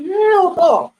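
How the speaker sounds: high, sharp "hell no"; horrified denial. Retold or imitated. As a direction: recoil in disbelief. Reacting to blood.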